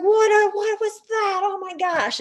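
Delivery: very high-pitched mock panic; acted freak-out. Kids after the owl swooped by, acted freak-out. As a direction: squeal it like a startled kid. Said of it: "freaked out".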